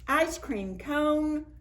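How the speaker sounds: usual speaking voice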